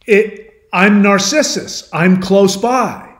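high, loud, slow call. Retold or imitated. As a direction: announce yourself across a distance. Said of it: "he's calling out".

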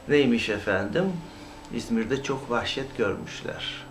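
normal talking voice

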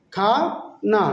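"খা", pause, "না"; long "খা", then a separate "না"; broken into syllables. Shown as a demonstration. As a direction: sound out "খানা" in two separate parts. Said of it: "নেপালিতে বলবো খানা".

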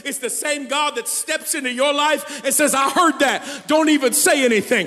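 loud, high shouted preaching; full-force preaching. Acted. Pastor voicing God answering the doubters, shouted preaching. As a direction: proclaim it with fire.

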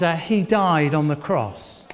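usual speaking voice

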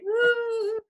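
high, drawn-out "woo"; playful whoop. Retold or imitated. Mimicking singers having fun dancing, playful whoop. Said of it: "having fun, like".

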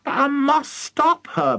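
high-pitched desperate wail; high, frantic character protest. A narrator's reading. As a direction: wail it in distress. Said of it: "Missus Cranky wailed".

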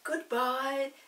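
Chinky yelling a cheeky goodbye to the Snuggle; mock yelled farewell. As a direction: call it out cheekily as you fly off. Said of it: "yelled Chinky".

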